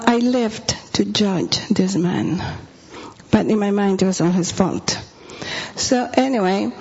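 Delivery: ordinary talk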